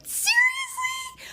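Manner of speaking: very high, drawn-out squeal of disbelief; outraged disbelief. Retold or imitated. Woman climbing into boyfriend's car without flowers, outraged. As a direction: stretch it out, shrieking in reproach. Retold confrontation over missing flowers.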